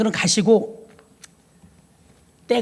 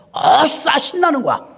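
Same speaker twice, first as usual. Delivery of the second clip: ordinary talk, nothing marked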